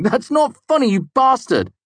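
mock angry yell. A narrator's reading. Simon yelling at Kvothe after the prank, high and loud. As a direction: shout it at a friend who fooled you.

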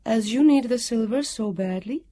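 high, ringing, stately woman's voice; strong and comforting. A narrator's reading. Guardian agreeing to break her injunctions, strong comforting voice. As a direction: pronounce it with reassuring authority. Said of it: "Her voice rang out strong and comforting".

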